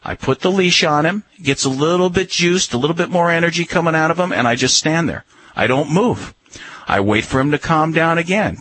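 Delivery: normal talking voice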